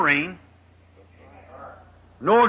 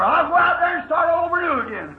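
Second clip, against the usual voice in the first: high-pitched hammed-up grumble; mock complaint; 7 semitones higher than usual. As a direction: act fed up, voice pitched up. Imagined Noah whining about popularity.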